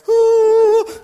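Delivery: very loud, high drawn-out yell; mock battle scream. Preacher re-enacting the film battle, mock war cry. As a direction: let out a big hammed-up war cry.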